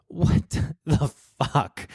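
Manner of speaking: slow, laughing through the words; can't believe it, laugh it out; real laughter breaking in, slowed right down; laughing disbelief. Real, not acted.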